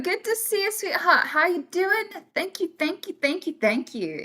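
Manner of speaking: normal talking voice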